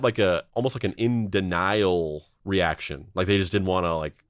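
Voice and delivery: ordinary talk, nothing marked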